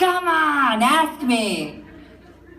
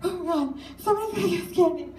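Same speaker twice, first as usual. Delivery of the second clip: higher, fast mock panic; mock freaking out; 7 semitones higher, 5 dB quieter, faster than usual. Kids mocking mom's fear of candy strangers, higher and fast. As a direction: squeal it out as a mock-scared kid. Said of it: "like, freaking out".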